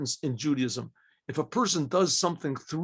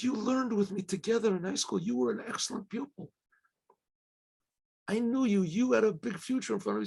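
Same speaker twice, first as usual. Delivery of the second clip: higher and softer, voice near tears; tearful, choked pleading; 5 semitones higher, 5 dB quieter than usual. Retold or imitated. Recalling a lost friend's promise, voice catching. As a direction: judge weeping at his old classmate, higher and softer through tears. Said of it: "he starts to weep".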